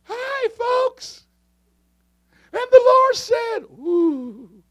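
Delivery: very high falsetto, wailing and dainty; mock pansy preacher. Preacher mocking timid young ministers, mock pansy falsetto. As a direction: squeal it as a hammed-up wimpy preacher. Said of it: "oh my, you pansy".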